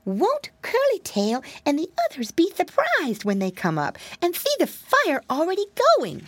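high, eager little piggy voice; a pig boy delighted at his own surprise; squeaky piglet plotting a surprise; piglet thinking eagerly. Acted. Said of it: "thought Flop Ear".

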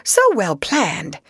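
high, brisk, hearty voice; cheerful bustle. Acted. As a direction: bustle about and cheer him up. Rat bustling, cheering up Mole.

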